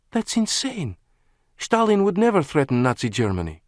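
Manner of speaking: high, indignant woman's voice. A narrator's reading. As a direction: scoff at the very idea. Lana indignant.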